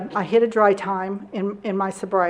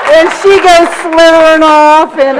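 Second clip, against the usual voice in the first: laughing still; 6 semitones higher, 13 dB louder than usual. Real, not acted. Laughing through the story.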